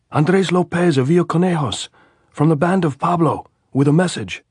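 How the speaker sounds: high, loud answer across the wire; shouting. A narrator's reading. Andres answering the sentry's challenge, shouting. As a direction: yell it up so they hear.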